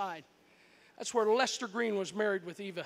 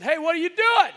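loud, higher call up to the roof; yell; 6 semitones higher, 10 dB louder than usual. Retold or imitated. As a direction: shout up from the truck. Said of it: "he yelled out to my dad".

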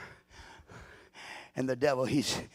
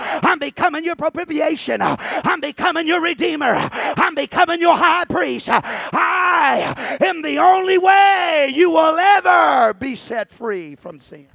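loud, slow climactic preaching; preaching at full force; 14 semitones higher, 15 dB louder, slower than usual. Acted. Preacher shouting to the church at full force. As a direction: thunder it to the congregation.

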